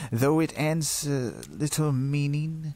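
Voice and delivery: plain reading voice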